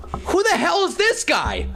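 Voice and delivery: high, slow, incredulous yell; outraged. Real, not acted. Fan ranting at the screen, high incredulous yell. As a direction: demand an answer in disbelief.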